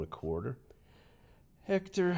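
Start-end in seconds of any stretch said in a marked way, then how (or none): 1.6-2.1 name snapped out high and loud; a teacher's stern warning call. Retold or imitated. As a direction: call him out sharply by name. Teacher scolding lying boy.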